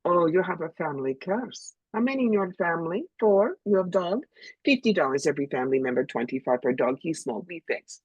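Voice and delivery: put-on lightly done broken-English fortune teller accent; touch of a hustling fortune teller accent.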